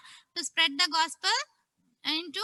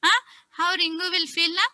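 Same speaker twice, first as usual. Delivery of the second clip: ordinary delivery, nothing marked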